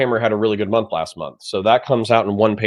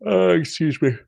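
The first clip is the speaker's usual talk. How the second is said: yawning; 8 semitones higher, 5 dB louder than usual. Real, not acted. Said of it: "I'm sorry for yawning".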